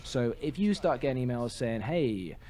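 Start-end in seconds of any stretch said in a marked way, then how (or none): none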